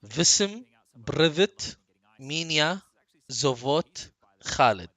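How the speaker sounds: word by word, loud, each rising until the last; loud list-like delivery with pauses between words; careful attempt. Shown as a demonstration. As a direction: sound out each foreign word separately. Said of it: "I will try".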